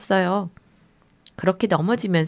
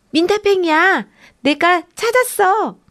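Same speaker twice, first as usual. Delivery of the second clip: high, loud excited call; shouting loudly; 8 semitones higher, 4 dB louder than usual. Acted. Boy calling out to his sisters about a slug, loud and high. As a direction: call out the find proudly. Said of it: "빌리가 크게 소리쳤어요".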